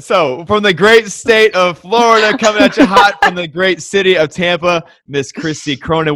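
ring announcer intro. Pretend. Host introducing guest from Tampa, big hammed-up announcer call. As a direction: bring her in like a boxing announcer.

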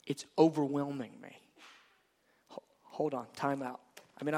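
hushed, breathless faltering; overwhelmed. Acted. God to his bride, faltering in a hushed voice. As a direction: falter softly, undone by her eyes.

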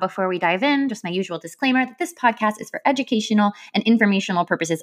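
rapid legal disclaimer voice; fast disclaimer read. Acted. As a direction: rattle off the disclaimer quickly.

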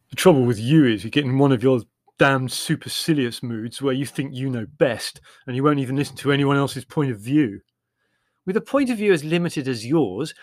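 loud, irritated sibling quarrel; bickering. Acted. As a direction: snipe at each other crossly. Acted quarrel between siblings.

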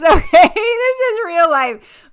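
high, loud laughing outburst; laughing exclamation. Real, not acted. Apologizing to listeners for the sneezing dog, laughing exclamation. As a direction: laugh it out loud, pitch shooting up, drawn out.

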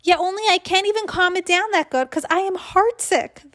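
high-pitched agitated protest; worked-up child. A narrator's reading. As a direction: insist you cannot calm down. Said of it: "Missus said, Calm down, my voice".

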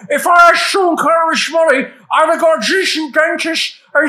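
high mock Cockney with slushy S sounds; hammed-up Cockney, lisping like Connery; Damian Lewis. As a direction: slur every S into SH in a high London accent. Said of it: "I can do Damian Lewis brilliantly".

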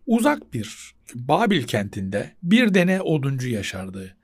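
slow storytelling read; story narration. Shown as a demonstration. Teacher demos reading a simple story, in slow narration. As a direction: start a fairy tale slowly.

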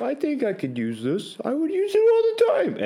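high mock enthusiastic self; lightly voiced past self, sold on a purchase; mock self quote. Past self talking himself into a buy, mock eager.

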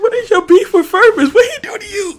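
high, loud, cracking-up outburst; helpless high laughing exclamation; laughing protest. Real, not acted. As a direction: demand an answer through real laughter. Laughing outburst challenging the other host.

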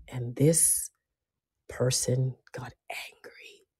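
hushed, breathless whisper. Real, not acted. Whispering to listeners about the person who got angry. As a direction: drop to a whisper for the reveal.

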